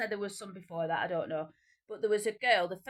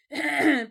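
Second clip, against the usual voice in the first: throat clear; 6 semitones higher, 12 dB louder than usual. Real, not acted. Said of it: "I don't know why I did that noise then".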